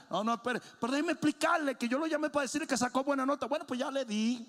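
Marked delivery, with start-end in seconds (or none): 0.1-3.5 rushed, higher teacher trying to explain; hurried explanation. Retold or imitated. Teacher rushes to explain to the father, fast. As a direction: blurt it out fast before the beating. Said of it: "Y él decía".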